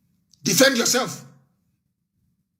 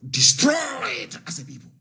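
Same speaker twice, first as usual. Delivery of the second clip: sudden shout; 7 semitones higher than usual. Real, not acted. Speaker to listeners, shouting "destroyed". Outburst on the same phrase.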